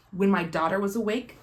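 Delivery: ordinary talk, nothing marked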